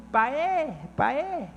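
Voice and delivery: a hint of a delighted toddler. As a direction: call Daddy the way his small daughter does. Father voices his toddler daughter.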